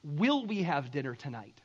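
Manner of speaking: low, sober question; grave worry. Acted. Poor family wondering about any dinner, gravely. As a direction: ask it low and weightily, as real need. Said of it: "This is like".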